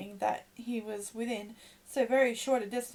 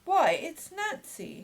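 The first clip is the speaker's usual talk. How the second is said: higher, slow astonished cry; exclaimed; 6 semitones higher, 5 dB louder, much slower than usual. A narrator's reading. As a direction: gasp it out in recognition, slowly. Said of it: "exclaimed Oliver".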